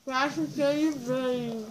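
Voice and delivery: cheeks stuffed, slow and mushy; barely getting words past marshmallows; mouth full. Real, not acted.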